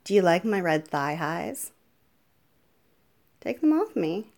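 ordinary talk

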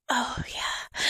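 hushed breathy oh yeah; whisper. Acted. Mistress murmuring approval to her pet, a whisper.

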